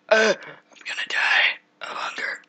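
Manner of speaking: whimpering, whispered, slow and high; fake-sob about starving; mock crying whisper. Gamer starving in game, mock crying whisper.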